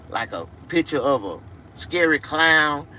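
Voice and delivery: ordinary delivery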